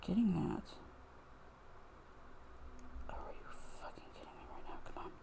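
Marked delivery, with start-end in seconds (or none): 3.1-5.1 fast, exasperated whispered curse; frustrated whisper. Real, not acted. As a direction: hiss it out in disbelief, under the breath. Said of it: "Oh, I'm getting very frustrated".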